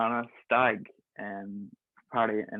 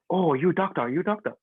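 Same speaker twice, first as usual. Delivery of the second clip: a mock Vietnamese accent, pitched higher; 7 semitones higher, 3 dB louder than usual. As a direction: imitate a Vietnamese cop, broken English. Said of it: "saying it in Vietnamese".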